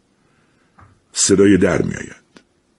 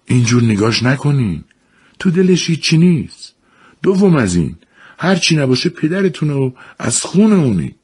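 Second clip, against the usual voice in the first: mother's voice; 10 semitones higher than usual. A narrator's reading. Mother defends father to sons.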